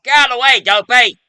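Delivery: high, loud shout; yelling. Retold or imitated. Imitating Dundee shouting at a bull, yelling. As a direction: holler it at full force. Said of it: "he was like".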